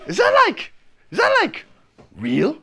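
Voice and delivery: very high, hesitant acted question; hammed-up high-pitched disbelief; incredulous questioning. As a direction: squeak out a stunned question. Acted naive question about porn.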